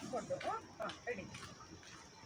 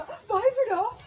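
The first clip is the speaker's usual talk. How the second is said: high, slower rising demand; excited; 6 semitones higher, 4 dB louder, much slower than usual. Shown as a demonstration. Director shows the right take, excited rising voice. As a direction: push it up with excitement. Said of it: "ವಾಯ್ಸಲ್ಲಿ excitement ಬೇಕು".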